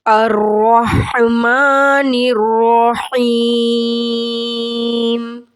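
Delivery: slow, drawn-out tajweed chant; measured Quranic recitation. Acted. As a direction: recite it melodically, stretching the vowels.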